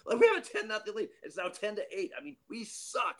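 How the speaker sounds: high, mock disgusted complaint; imitating a manager fed up with his team. Manager griping about his team, high and mock disgusted. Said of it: "He goes".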